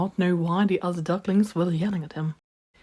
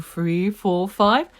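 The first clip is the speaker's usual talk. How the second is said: plain reading voice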